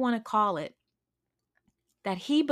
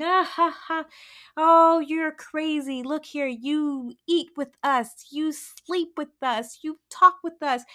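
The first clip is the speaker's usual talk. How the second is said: mocking chickens; 6 semitones higher, 4 dB louder than usual. Chickens laughing the eagle back into line, high and mocking. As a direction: talk him down like a silly child.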